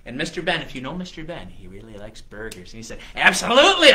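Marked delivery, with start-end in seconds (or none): 3.2-3.9 loud, high, emphatic yes; eager. Acted. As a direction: jump at the offer with a booming voice. Hungry guest accepts burger challenge.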